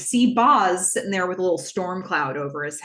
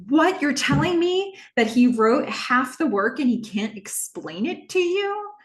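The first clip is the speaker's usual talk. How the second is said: pitched up high and loud in disbelief; a shocked what, climbing higher; incredulous; 8 semitones higher, 3 dB louder than usual. Retold or imitated. Kim cannot believe Boz is asking this, high incredulous outburst.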